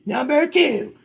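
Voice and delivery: high, lively storybook read; reading to children. Acted. Parent reading the dog's story to kids, high and lively. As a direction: tell the puppy's tale brightly to the kids.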